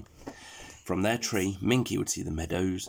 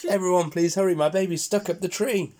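high, louder frantic plea; worried plea; 9 semitones higher, 4 dB louder than usual. Acted. Mother pig begs the animals to hurry, high frantic plea. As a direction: beg them to hurry, in a panic. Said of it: "beside herself with worry".

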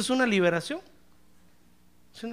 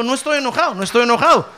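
raised protest building to a shout; mock loud denial, higher and louder; ending up shouting; 5 semitones higher, 7 dB louder, much faster than usual. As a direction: insist loudly, imitating an accidental yell. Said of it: "termino gritando".

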